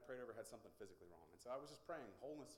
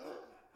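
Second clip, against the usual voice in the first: loud astonished mock gasp; imitated gasp; 7 dB louder than usual. Villagers seeing another bag lifted, astonished gasp. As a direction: gasp hard as an amazed onlooker. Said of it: "And they're like".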